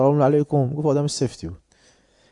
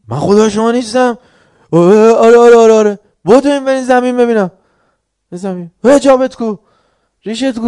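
higher and much louder mock bully; mock shouting; 9 semitones higher, 11 dB louder than usual. Teacher acting out a harsh ruler yelling at people, loud mock shout. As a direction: yell orders like a bossy overlord.

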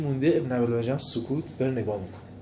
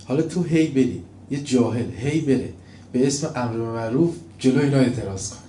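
ordinary talk, nothing marked

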